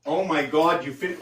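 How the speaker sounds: ordinary delivery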